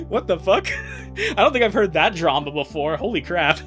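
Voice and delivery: ordinary talk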